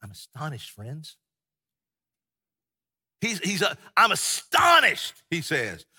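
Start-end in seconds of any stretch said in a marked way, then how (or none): 0.0-1.2 much softer, gentle and polite; the polite version Paul never used; soft mild take. Shown as a demonstration. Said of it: "he's not saying". 4.0-5.1 high, loud and slow astonished cry; Paul rebuking the Galatians with outrage; outraged. Shown as a demonstration. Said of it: "if we could hear Paul say this".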